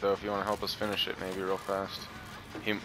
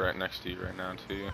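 usual speaking voice

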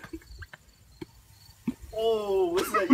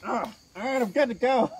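muffled through a gas mask; 5 semitones higher, 5 dB quieter, faster than usual. Real, not acted. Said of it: "let me get my gas mask on".

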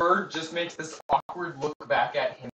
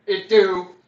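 loud mock shout; 5 semitones higher, 16 dB louder than usual. Joke answer shouted over the stream.

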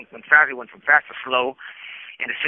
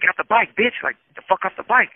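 a mock yell pitched up high, hurling the insult; imitating his raised, aggressive shout; retold angry yell; 8 semitones higher, 5 dB louder than usual. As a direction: bark it like the man in the yard did, loud and up. Angry man yells threat at me.

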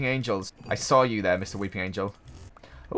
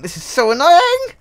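much higher and louder frustrated cry; exasperated shriek; 9 dB louder than usual. Real, not acted. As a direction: vent the frustration in a squeal. Stuck in glitch, house burning.

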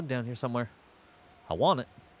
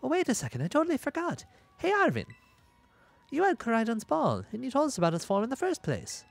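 put-on bright eager teen, pitched up high; high excited girl voice; 8 semitones higher than usual. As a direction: gush about it, pitched well up. Voicing an excited character.

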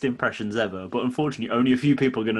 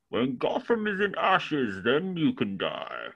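slow, masked villain voice pitched up; Bane impression; 3 semitones higher, much slower than usual. To his co-host on request, a slow Bane impression. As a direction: menace the city slowly, like Bane. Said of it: "I can kinda do Bane from Batman".

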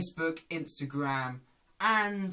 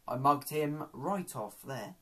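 usual speaking voice

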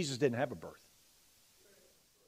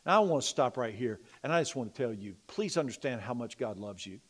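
ordinary talk, nothing marked

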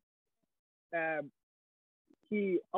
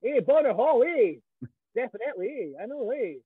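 put-on loud, higher Fife accent shouting hey; 4 semitones higher, 4 dB louder than usual. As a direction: bellow venue names in a hammed-up Fife accent. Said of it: "in a Fife accent".

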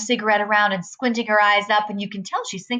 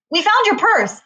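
sudden high, louder burst; blurted out; 10 semitones higher, 5 dB louder than usual. A narrator's reading. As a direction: blurt it out in a nervous rush of pitch. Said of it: "Freak blurts out".

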